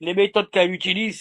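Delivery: usual speaking voice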